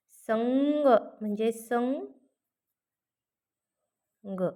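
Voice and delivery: संघ म्हणजे संघ drawn out very slowly, each संघ with the nasal held; slow demo pronunciation. Shown as a demonstration. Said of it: "इथं पण ग चाच उच्चार होतोय बघा". (संघ म्हणजे संघ सावकाश, अनुनासिक स्पष्ट)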